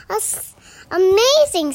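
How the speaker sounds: high, loud cry of wonder; amazed exclamation. Acted. Gruffalo impressed by the mouse, amazed exclamation. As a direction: exclaim it with rising pitch and volume.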